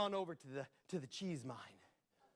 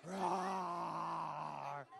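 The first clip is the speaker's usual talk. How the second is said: dragon roar; 4 dB quieter than usual. Acted. The insulted dragon answering Jack, roaring. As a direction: roar back in rage.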